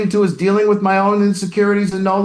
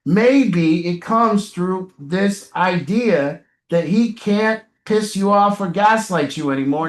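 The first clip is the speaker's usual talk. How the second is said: usual speaking voice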